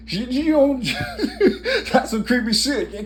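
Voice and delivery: high-pitched laughing talk; squeaky voice through laughs. Real, not acted. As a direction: break into high laughter while talking. Laughing over creepy thought.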